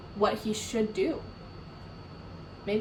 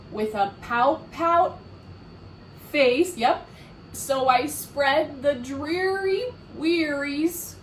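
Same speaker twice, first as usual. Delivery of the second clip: slow, louder chant for the class; slow refrain together; 4 semitones higher, 3 dB louder, slower than usual. Shown as a demonstration. Reader chanting with children, slow and loud. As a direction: say it slowly so they can follow. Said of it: "Let's try it together".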